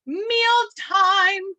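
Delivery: high, loud sing-song dinner call. Acted. Penguin parent calling its chick to eat, sing-song call. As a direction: call it out high and loud, stretching both words.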